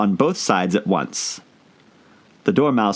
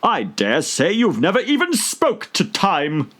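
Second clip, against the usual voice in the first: contemptuous; 11 semitones higher than usual. A narrator's reading. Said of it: "tossing his head contemptuously".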